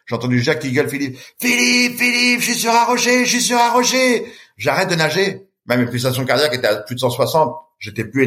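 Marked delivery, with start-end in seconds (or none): 1.4-4.3 high-pitched call, drawn out. Retold or imitated. Swimmer calling to the boat from the rock, high and drawn out. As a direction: shout so he hears you on the rock.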